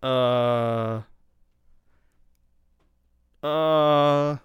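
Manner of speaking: going up the scale. Shown as a demonstration. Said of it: "I'm just gonna go up the scale".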